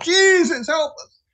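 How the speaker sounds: high, loud desperate plea to Jesus; cried out prayer. Retold or imitated. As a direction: cry out for help as the crash comes. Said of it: "I just cried out".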